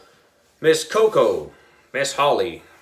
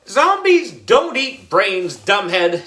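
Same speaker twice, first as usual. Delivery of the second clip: higher-pitched, drawn-out schoolkid put-down; scornful retort; 6 semitones higher, 5 dB louder, slower than usual. A narrator's reading. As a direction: put a know-it-all classmate down, high and slow. Scornful retort in a playground squabble.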